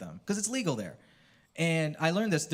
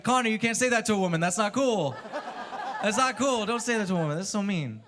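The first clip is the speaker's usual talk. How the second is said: mock scolding; 7 semitones higher than usual.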